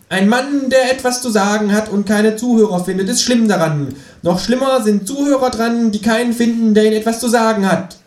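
high, declaiming kangaroo voice; kangaroo proclaiming. Acted. Kangaroo orating from the tabletop, high and grand. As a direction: boom it out like a speech from the table. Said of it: "springt auf den Tisch und tönt".

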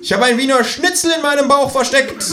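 high, pushy kangaroo voice; a cheeky high voice for the Kangaroo. A narrator's reading. Känguru nags the narrator to clean the bath, high and pushy.

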